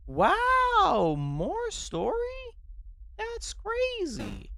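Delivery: loud, high mock amazement, drawn out; sarcastic wow. Real, not acted. Streamer groaning at another cutscene, mock amazed. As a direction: fake awe at yet another cutscene.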